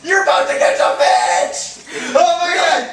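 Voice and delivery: very high, loud, thrilled shout; excited shouting. Real, not acted. Player about to get the fish, shouting excitedly. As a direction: shout it as the catch gets close.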